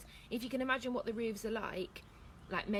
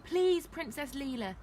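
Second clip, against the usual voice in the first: higher, louder appeal; urgent plea; 6 semitones higher, 6 dB louder than usual. A narrator's reading. Gamage to Princess Leela, urgent plea. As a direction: beg with raised voice.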